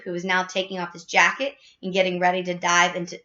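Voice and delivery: usual speaking voice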